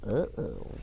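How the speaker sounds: a low, soft uh-oh; hint that trouble is coming. Acted. Teacher warns the children of trouble, low and soft.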